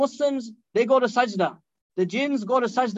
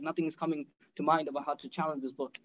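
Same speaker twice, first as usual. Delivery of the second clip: frustrated; 7 semitones lower, 9 dB quieter than usual. Retold or imitated. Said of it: "He's, like, frustrated, he's upset".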